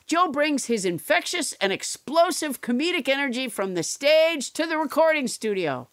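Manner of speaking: booming announcer ad read; loud promo read. Acted. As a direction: sell it loud like a radio promo.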